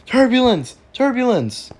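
very high-pitched alarmed cries; hammed-up fright as everything shakes; playful panic. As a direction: squeal out the alarm. Plane shakes in turbulence.

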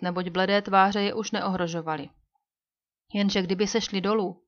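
ordinary delivery

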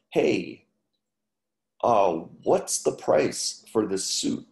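usual speaking voice

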